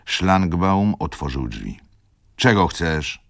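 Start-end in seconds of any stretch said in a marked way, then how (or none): 2.4-3.2 a hint of curt roughness; gruff question. A narrator's reading. Szlangbaum curtly asks the servant, a touch gruff. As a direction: brush him off lightly. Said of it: "szorstko zapytał".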